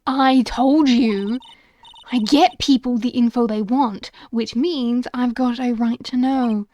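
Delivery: lightly voiced insistent reporter; pushy reporter. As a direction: stand your ground, a touch emphatic.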